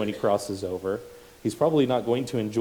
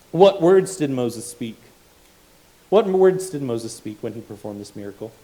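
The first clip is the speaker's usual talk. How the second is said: louder, pressing repeated question; loud rhetorical questions; 5 semitones higher, 7 dB louder than usual. Acted. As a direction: hammer the question home.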